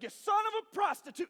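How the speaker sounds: high, loud mock rage; acted angry outburst. Saul lashing out at his own son, high angry shout. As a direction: hurl the insult, hammed up. Acts out Saul's angry insult.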